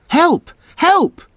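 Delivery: high, loud cry for help; calling for help. A narrator's reading. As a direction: call out in panic while spinning. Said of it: "called Chip".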